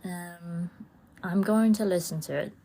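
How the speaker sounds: ordinary delivery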